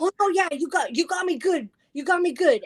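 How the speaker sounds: high-pitched, excited mimicry of a guest; imitating impressed guest. Retells scared guy's delighted reply.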